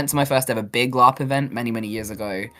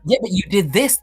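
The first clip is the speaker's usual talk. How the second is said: retold encouraging friend; 7 semitones higher than usual.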